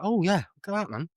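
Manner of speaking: thrilled to see the band's shirt; excited stranger. Retold or imitated.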